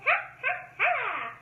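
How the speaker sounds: high witch laugh; witch cackle. Shown as a demonstration. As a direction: cackle like a witch on her broom. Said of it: "The witch on her broom goes".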